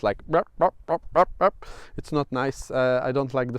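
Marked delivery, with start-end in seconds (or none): 0.3-1.7 choppy robotic burps, pitched up; robot noises. Retold or imitated. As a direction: mimic a jerky robot. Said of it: "I sound like a robot".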